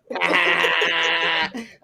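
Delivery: drawn-out open laugh; long burst of laughter; real laugh. Real, not acted. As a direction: laugh at being caught teasing. Caught mocking someone's accent.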